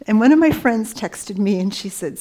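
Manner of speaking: ordinary delivery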